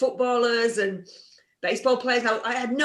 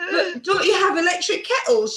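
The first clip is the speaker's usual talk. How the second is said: incredulous cry; 8 semitones higher than usual. Retold or imitated. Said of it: "I'm going".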